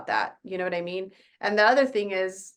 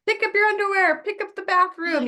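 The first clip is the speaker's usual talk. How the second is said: much higher, louder mock nagging at the kids; hammed-up exasperated mom barking chores; 11 semitones higher, 5 dB louder than usual.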